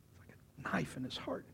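soft, whispered pain; hushed aside. A narrator's reading. Preacher describing Peter's hurt at the question, hushed. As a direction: whisper it as if it hurts.

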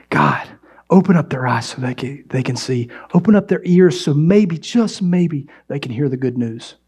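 hushed prayer. Real, not acted.

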